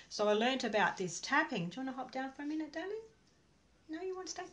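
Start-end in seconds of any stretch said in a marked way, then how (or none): 1.7-4.4 high, soft coaxing to a dog; gentle high voice for a pet; pet talk. Real, not acted. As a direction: sweet-talk the little dog down. Talking to her dog on lap.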